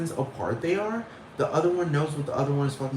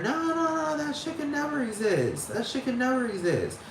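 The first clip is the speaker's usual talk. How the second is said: high-pitched naysayer voice; mocking denial; 10 semitones higher than usual. Mocking people who say it can't exist, high-pitched denial. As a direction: dismiss it in a high mock protest.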